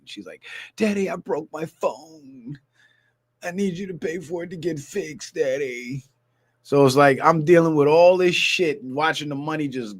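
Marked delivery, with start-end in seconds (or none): 0.8-6.0 imitating crying daughter. Dad imitates his crying daughter begging for phone money, a light whiny voice. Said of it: "she crying".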